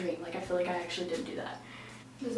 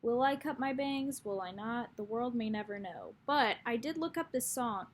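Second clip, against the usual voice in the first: ordinary talk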